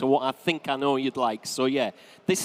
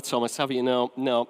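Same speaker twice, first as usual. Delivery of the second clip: ordinary delivery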